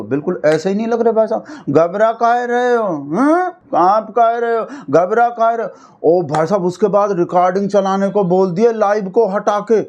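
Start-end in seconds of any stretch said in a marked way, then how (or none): 1.7-5.7 higher-pitched singsong teasing; mock taunting. Commentator turning a film line on the spokesman, mock taunting. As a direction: tease with hammed-up film-dialogue questions.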